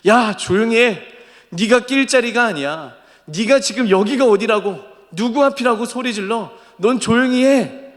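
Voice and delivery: high, forceful telling-off; overbearing scolding. Acted. Preacher as the crowd silencing Bartimaeus, overbearing rebuke. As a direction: shut him up with heavy authority. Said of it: "강압적으로, 고압적으로 조용히 하라고".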